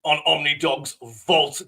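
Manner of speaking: loud hyped announcer welcome; big showman opening, loud; announcer intro. Acted. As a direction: boom it out like a ring announcer.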